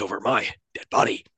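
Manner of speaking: stilted mock film-hero line; badly delivered line. Co-host quoting the film's hero, stilted. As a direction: deliver the threat woodenly, word by word. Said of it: "Like that".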